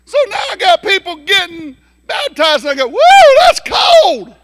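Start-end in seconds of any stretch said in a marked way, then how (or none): 2.9-4.4 very high, loud, slow yelp; shocked by cold water. Retold or imitated. Someone baptized in an unheated horse trough, shocked yelp. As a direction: yelp as the icy water hits.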